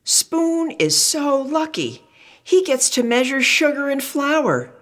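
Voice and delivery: a high-voiced utensil wishing; friend character voice. Another friend envies Spoon.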